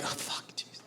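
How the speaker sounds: whispered curse under the breath; barely audible, dreading aside; muttered aside. Retold or imitated. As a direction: whisper it to yourself. Muttered aside before answering mom.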